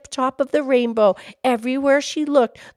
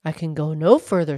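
low-pitched fish voice; 9 semitones lower than usual. A narrator's reading. Fish halting at the rainbow's foot, low voice.

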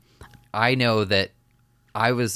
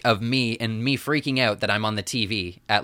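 normal talking voice

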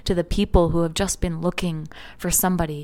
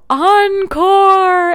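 sudden high shouted Encore; pretend yell; 13 semitones higher, 13 dB louder than usual. Imagining yelling encore in the silent ceremony room, loud shout. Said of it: "What if I just yelled out".

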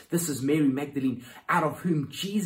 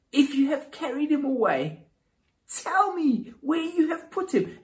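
much higher, louder desperate plea; pleading Mary; 12 semitones higher, 4 dB louder than usual. Pretend. Mary begging the supposed gardener, high pleading. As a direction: beg him to tell you where.

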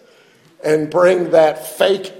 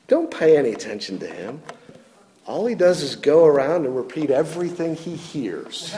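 lightly voiced dismissive deacon; 3 dB quieter than usual. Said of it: "the deacon said to him".

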